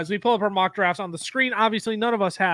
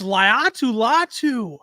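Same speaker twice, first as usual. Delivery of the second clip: draft announcer; 3 semitones higher, 4 dB louder, slower than usual. Pretend.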